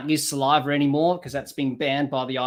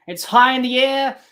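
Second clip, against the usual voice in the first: excited call; 9 semitones higher, 7 dB louder than usual. Real, not acted. Ball skied, appeal follows.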